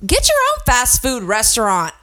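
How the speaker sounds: mock rant. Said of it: "I'm like".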